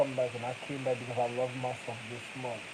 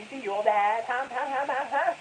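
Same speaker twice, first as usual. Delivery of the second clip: high taunting homegirl voice with huh huhs; mocking homegirl; 8 semitones higher than usual. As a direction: tease like a sassy homegirl. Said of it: "Homegirl look at me".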